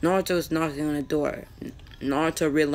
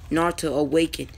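plain reading voice

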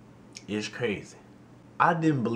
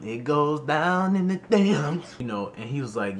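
ordinary talk, nothing marked

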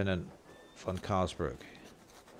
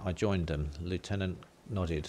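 usual speaking voice

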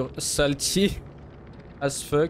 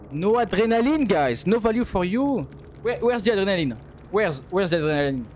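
taunting call; 3 semitones higher than usual. Acted. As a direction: call it out to rub it in. Shouts the taunt at opponent.

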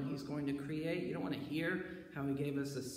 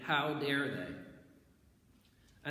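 slow drawn-out how dare they; indignant; much slower than usual. Acted. Preacher voicing the offended rulers, slow and indignant. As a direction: let the outrage stretch each word.

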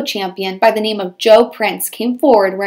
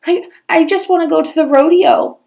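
sobbing; 7 semitones higher than usual. A narrator's reading. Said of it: "sobbed Cindy Ellen".

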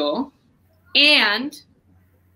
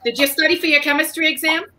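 Cheryl Cohen; 6 semitones higher than usual. Acted. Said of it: "ask you questions as Cheryl Cohen".

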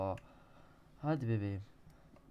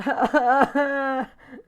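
high, loud disbelieving laughter; exasperated laugh; 12 semitones higher, 14 dB louder than usual. Real, not acted. Gamer laughing in despair at his fall.

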